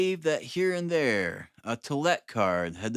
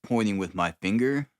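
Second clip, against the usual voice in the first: plain reading voice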